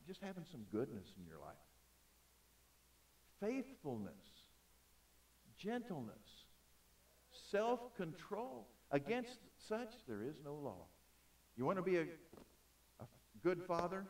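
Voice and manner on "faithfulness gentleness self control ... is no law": one word at a time, drawn out; slow list with long pauses between words; reading slow. Real, not acted. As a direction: let each item stand alone, deliberately. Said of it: "even though I'm reading slow".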